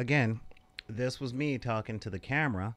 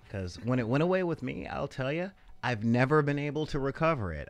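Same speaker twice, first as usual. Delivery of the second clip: usual speaking voice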